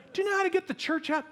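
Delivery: high, rushed mock questioner; pestering church member. Church people come up to the pastor, pestering. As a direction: rattle it off high like an eager parishioner.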